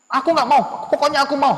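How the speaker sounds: high, loud insistence. Acted. Sulking child at the mall, loud stubborn protest. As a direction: dig in loudly like a stubborn kid. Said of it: "Suaranya dibesar".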